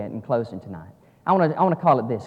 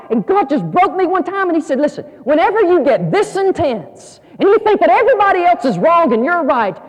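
high, impassioned pulpit delivery building louder; full-force preaching; 12 semitones higher, 7 dB louder than usual. Real, not acted. Preacher confessing how God broke him, high impassioned preaching. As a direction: drive it home to the congregation.

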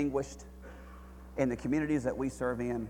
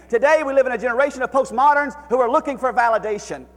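high, loud preacher's cry to God; impassioned sermon climax; full-force preaching; 10 semitones higher, 12 dB louder than usual. Acted. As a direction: plead it from the pulpit, full voice.

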